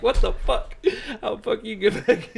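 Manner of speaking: high, laughing what the fuck; shocked disbelief. Retold or imitated. Shocked guy sees him again.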